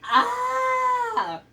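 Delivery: long, high scream; startled scream. Real, not acted. Viewer watching the roller coaster rescue, startled scream. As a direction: scream loud and high, held on one note. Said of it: "felt my soul leave my body".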